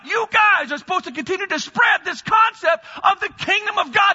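shouting, high preacher climax; preaching. Real, not acted. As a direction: build to a fiery shout. Preaching at full force.

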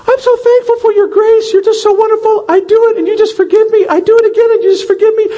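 high, gushing, hammed-up carefree sinner, speeding up; sing-song a cheap thank-you to God; mock sinner prayer. Mocking careless sinner's prayer.

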